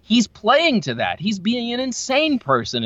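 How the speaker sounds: retold revelation, high-pitched and drawn out; sudden realization. Moviegoer re-seeing a performance in a theater, sudden realization. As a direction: act out a delighted penny-drop moment, pitched high.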